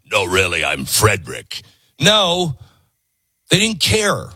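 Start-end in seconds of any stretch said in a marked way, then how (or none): 0.1-1.6 mock deep man voice. Woman faking a man's voice to the clerk, deep and hushed. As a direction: drop your voice low to pass as a man.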